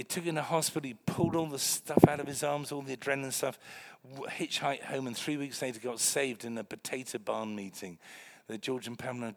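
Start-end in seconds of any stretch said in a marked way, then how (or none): none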